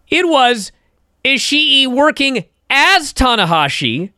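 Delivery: building thrill as the twist lands; excited. Real, not acted. Host to listeners, thrilled by the wrestlers' role swap, getting excited. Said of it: "I'm so excited".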